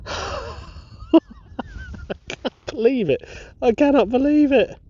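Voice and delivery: high, loud, laughing disbelief; overwhelmed with joy; giddy disbelief. Real, not acted. Detectorist cannot believe his coin find, high and loud.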